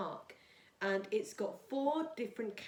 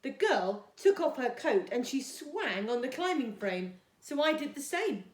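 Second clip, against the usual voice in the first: put-on storytime reading for young children; gentle storybook read-aloud for Year two; reading a story; 3 semitones higher, 7 dB louder than usual. A narrator's reading.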